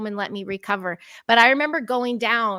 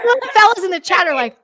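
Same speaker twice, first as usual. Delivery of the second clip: laughing banter; 9 semitones higher, 8 dB louder, faster than usual. Real, not acted.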